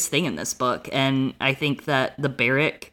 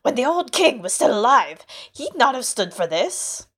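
put-on high, pinched old man's voice; a bitter old villager grumbling; 8 semitones higher than usual. A narrator's reading. Old man lamenting to villagers, high grumble.

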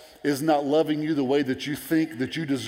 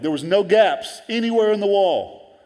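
loud, higher-pitched triumphant quote; emphatic boast; 8 semitones higher, 8 dB louder than usual. Acted. Preacher quoting Nehemiah on his finished wall, emphatic boast. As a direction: proclaim it big, stretching the key words.

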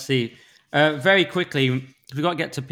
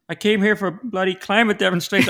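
put-on higher-pitched, failed Dutch accent; botched Dutch accent; 7 semitones higher than usual. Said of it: "I should just do my own impressions".

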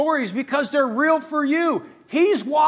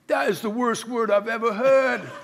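lightly put-on English accent; a touch of exaggerated English accent. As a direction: voice a critic in a mock English accent. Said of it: "he was from England".

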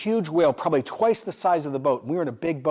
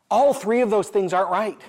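ordinary talk, nothing marked

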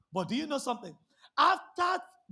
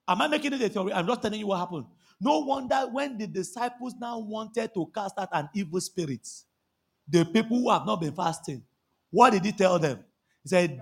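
normal talking voice

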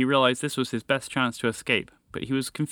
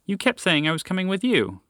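higher, puzzled explanation; a bit confused; 8 semitones higher than usual. A narrator's reading. Moose explaining to the lab, a bit confused. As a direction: explain with mild bewilderment. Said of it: "now a bit confused himself".

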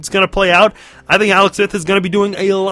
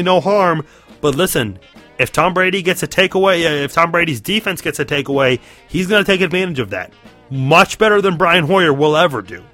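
ordinary talk

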